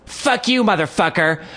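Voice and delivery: high-pitched mock yell; mimicked parting shot. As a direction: hurl it after someone in a put-on high voice. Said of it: "like a little parting shot".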